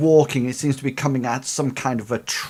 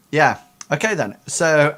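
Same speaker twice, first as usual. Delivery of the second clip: ordinary talk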